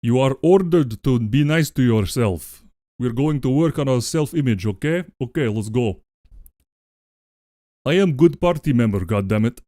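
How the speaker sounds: put-on Russian accent. Streamer voicing Comrade Goat in a light Russian accent. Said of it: "this accent. So let's do it in Russian".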